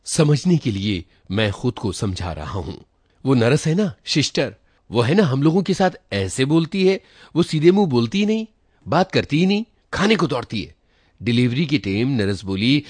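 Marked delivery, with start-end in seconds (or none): 3.2-10.8 higher, louder and fast, the grievance pouring out; blurting out his hurt about the nurse, voice up and pushing; agitated complaint. Retold or imitated. Villager complaining to the narrator about the nurse, agitated and loud.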